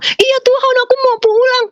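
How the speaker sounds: put-on high, childlike crying plea; like a small child. Answering God about going home, like a small child. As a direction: plead like a little child, very high. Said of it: "kayak anak kecil di hadapan Tuhan".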